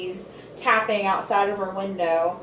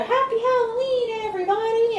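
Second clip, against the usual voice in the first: very high, sing-song holiday cheer; a high mock-sweet greeting from the vampire family; cheery family greeting; 13 semitones higher than usual. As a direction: chirp it like a cartoon family. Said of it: "that's when they go".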